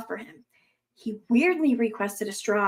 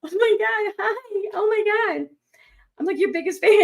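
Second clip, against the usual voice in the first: higher, breathless starstruck fan; gushing fan; 9 semitones higher than usual. Retold or imitated. A fan walking up to Johnny Depp gushes, higher and starstruck. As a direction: squeal hello to your idol. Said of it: "picture them walking up to Johnny Depp and saying".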